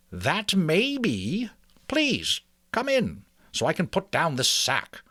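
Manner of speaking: put-on a wise old mouse greeting a visitor. Mister Ages voiced.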